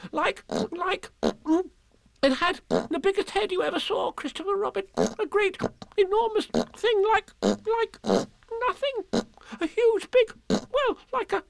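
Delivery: high, breathless, halting squeal; groping for words between puffs; Piglet. Acted. Piglet describing the heffalump, squealing and stammering. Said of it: "breathing so hard that he could hardly speak".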